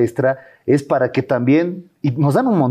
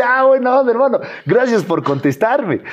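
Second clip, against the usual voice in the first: mock hater reply; 8 semitones higher than usual. Voicing the hater's friendly reply.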